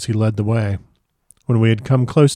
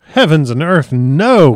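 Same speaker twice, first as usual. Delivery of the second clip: high, loud indignant refusal; outraged, impassioned refusal; passionate outcry; 4 semitones higher, 6 dB louder, slower than usual. A narrator's reading. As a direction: cry out in a storm of passion. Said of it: "cried Arthur in a storm of passion".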